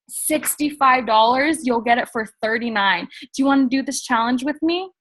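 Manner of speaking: bubbly and breathless; pitching weight-loss challenge to best friend, excited. Shown as a demonstration. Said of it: "If your best friend".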